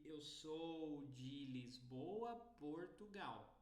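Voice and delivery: slow, open Brazilian Portuguese pronunciation; Brazilian Portuguese accent. Shown as a demonstration. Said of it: "In Brazilian Portuguese accent".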